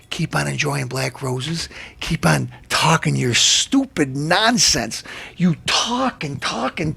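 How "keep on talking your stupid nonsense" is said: ordinary delivery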